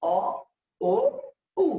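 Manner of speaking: অ, ও, উ spaced apart, moving from "aw" to "oo"; rounded back vowels. Shown as a demonstration. Teacher demonstrating the back series, অ, ও, উ as isolated rounded vowels. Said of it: "খেয়াল করেন".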